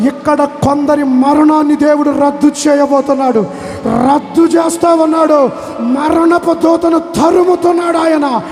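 building to a loud, high, slow proclamation; preaching at full force. Acted. Preacher proclaiming deliverance from death over the congregation. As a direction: roar the blessing over the crowd.

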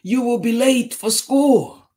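high, loud urgent call; mother crying out. A narrator's reading. Mommy rushing Tisha for school, high urgent cry. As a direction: cry out like a hurrying mom. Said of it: "cried Mommy".

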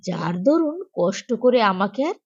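plain reading voice

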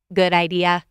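level robot voice on one note; robotic monotone reply. A narrator's reading. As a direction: answer like a robot, evenly. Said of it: "in his monotone robot voice".

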